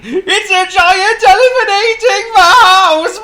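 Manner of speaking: very high, very loud elephant wail; panicked cry. A narrator's reading. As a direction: shriek it in hammed-up terror. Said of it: "he cried".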